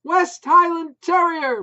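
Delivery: high, loud shout. A narrator's reading. Wife shouting news about the new dog, loud. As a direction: yell it over the dogs. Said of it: "Maureen called back".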